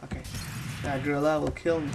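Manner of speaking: ordinary delivery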